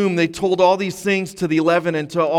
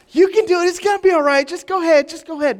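much higher, louder sing-song encouragement; coaxing the dog; 11 semitones higher, 3 dB louder, faster than usual. Retold or imitated. Owner urging his puppy to go, high cheering coax. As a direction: cheer the dog on like a proud parent. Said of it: "We're talking to dogs".

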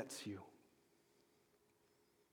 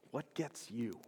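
ordinary delivery

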